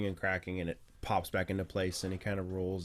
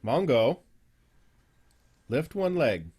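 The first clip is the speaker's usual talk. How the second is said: lightly voiced trainer, higher and louder on the command; animal trainer; 4 semitones higher, 8 dB louder, slower than usual. Trainer tells stone giant Mongo to lift a leg, encouraging command. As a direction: coax a big pet through a trick.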